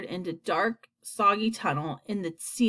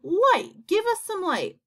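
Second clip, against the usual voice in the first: high, insistent calling; cried out; 11 semitones higher, 4 dB louder, slower than usual. A narrator's reading. Creatures to the glowworm in the dark, crying out. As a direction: call for it high, first word loudest. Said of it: "they cried".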